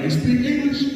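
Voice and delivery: high, drawling surprised question; country foreman. Retold or imitated. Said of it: "So he was like".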